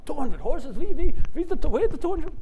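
high, loud, stammering disbelief; astonished stammer. Retold or imitated. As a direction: sputter in shock. Shmuel astonished by horsepower.

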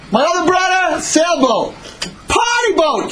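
high, crowing comparison; gloating excitement. Acted. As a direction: crow it high, topping the others. Son gloats over his boat.

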